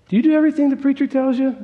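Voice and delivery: high, quick mock-incredulous question; challenging question. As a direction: needle him with a high-pitched question. Ned challenges Ed in acted quarrel.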